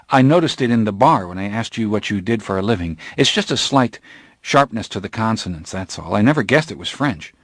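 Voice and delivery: usual speaking voice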